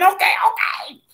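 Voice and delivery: high-pitched panicked holler, retold. Rider begging the speeding bobsled to stop, hollering. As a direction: holler it high, reliving the ride. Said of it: "But I was hollering".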